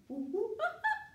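high hooting monkey laugh. Shown as a demonstration. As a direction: show the kids the monkey noise. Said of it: "Can you make that sound?".

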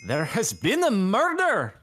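high, slow, hammed-up Scottish; attempted Scottish accent. Shown as a demonstration. Friends dare player to try it, overdone Scottish accent. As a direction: have a bad go at dramatic Scottish. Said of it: "your best Scottish accent".

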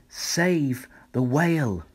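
the snail's trail pleading for the whale; proclamation. A narrator's reading. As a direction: spell out the message. Message written in trail.

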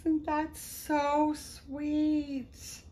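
high, slow, tender; tender coo. Real, not acted. Vlogger telling viewers about the romper, slow high coo. Cooing at a pre-loved romper.

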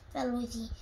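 ordinary delivery

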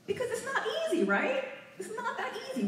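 high, laughing protest. Real, not acted. Speaker laughing as she defends people with anxiety.

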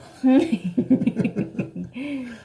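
loud burst of giggling; embarrassed laugh. Real, not acted. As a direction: laugh at your own failure. Failed attempt.

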